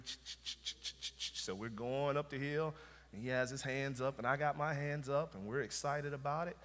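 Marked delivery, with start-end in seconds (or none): 0.0-1.4 whispered clicking ch-ch-ch; coaster cranking noise. Retold or imitated. Pastor mimicking the roller coaster lift chain, unvoiced clicks. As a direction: imitate the chain lift clanking softly. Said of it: "you can hear the cranking".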